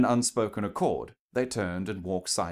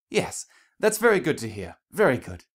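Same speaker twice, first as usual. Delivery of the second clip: Rarity's bright, higher voice, a little forced; forced cheer; 5 semitones higher, 5 dB louder than usual. Acted. Rarity covering awkwardness with forced brightness. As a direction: push out a polite, strained smile in the voice. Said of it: "She forced herself to smile again".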